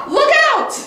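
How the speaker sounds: very high, loud cry of warning; warning shout. Acted. Peter warning the bird, high loud shout.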